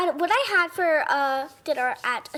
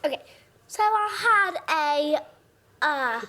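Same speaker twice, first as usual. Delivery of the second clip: attempted British accent. Shown as a demonstration. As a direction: try British and drift towards southern US. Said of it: "review in your British accent".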